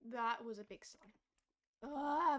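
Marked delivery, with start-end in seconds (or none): 1.8-2.4 voice cracking. Real, not acted. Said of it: "my voice!".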